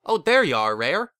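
loud, delighted call with rising end; grinning greeting. A narrator's reading. Father spotting his returning daughter, grinning greeting. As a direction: greet them through a wide grin. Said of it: "bursting into a wide grin".

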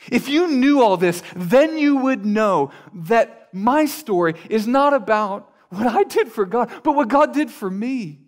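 building from measured to loud and high; impassioned testimony. Retold or imitated. Older saint testifying to what God did, impassioned. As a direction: preach it with rising fervor, hammering each clause.

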